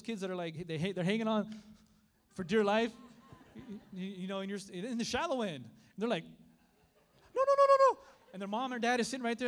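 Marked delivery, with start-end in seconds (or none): 6.2-8.0 very high, loud mock panic; panicked pleading. Preacher imitating kids hanging on for dear life, panicked. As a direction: squeal it like a terrified child.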